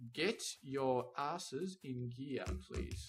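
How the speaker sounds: drawn-out, slow order; slow, exasperated nudge; slow mock command. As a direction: stretch out every word like a bored boss. Drawled order to lazy robots.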